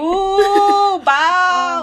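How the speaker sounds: "bow" for wow, drawn out high and loud in a teasing Nanny voice; mock Nanny voice. Granddaughter imitates Nanny mocking her: "bow" high and loud. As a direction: squeal "Ooh, bow" with W said as B. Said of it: "She says her W's with B's".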